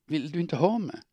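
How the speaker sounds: high, pleading cry; pleading question, pitched high; desperate plea. Acted. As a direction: beg him to want her. Woman pleads with lover.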